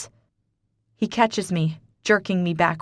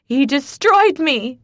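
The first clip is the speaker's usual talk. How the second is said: slow, loud, high anguished scream; drawn-out howl of pain; 8 semitones higher, 8 dB louder, slower than usual. Acted. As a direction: scream it from her knees. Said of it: "and I scream".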